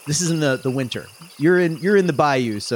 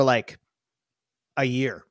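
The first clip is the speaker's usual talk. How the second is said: ordinary delivery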